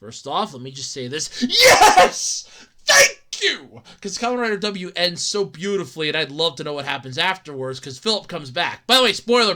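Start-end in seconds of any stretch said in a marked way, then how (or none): 1.5-3.8 high loud joyful shout; cheer. Real, not acted. Fan cheering Kamen Rider W sequel news, loud cheer. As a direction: celebrate out loud.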